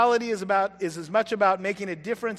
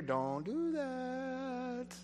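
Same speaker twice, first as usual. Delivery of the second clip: slow, soft whispered warning; inner whisper; 5 semitones higher, 10 dB quieter, much slower than usual. Pretend. The Holy Spirit warning a listener: soft slow whisper. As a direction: breathe it like a voice in your ear. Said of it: "you hear the whisper in your".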